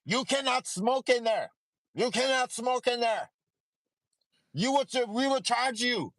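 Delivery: high, stern clerk on the phone; front desk voice. Retold or imitated. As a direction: play the hotel clerk scolding a guest. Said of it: "I get a call from the front desk".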